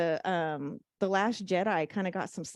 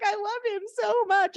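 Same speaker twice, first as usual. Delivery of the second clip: mock bawling; 14 semitones higher, 5 dB louder than usual. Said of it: "She was, like, bawling".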